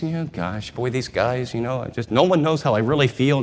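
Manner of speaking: soft, hurting murmur to herself; quiet grief spoken inward; hushed inner thoughts. Acted. Mary alone by the tomb, a soft hurting murmur.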